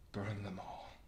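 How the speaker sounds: hushed, half-whispered command; quietly quoted order. Acted. Mad king to his pyromancer, hushed command. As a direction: give the order under the breath, softly. Quoting the king's order, low.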